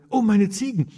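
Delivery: a high startled cry, goats forgotten; sudden alarm. A narrator's reading. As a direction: jolt up in surprise.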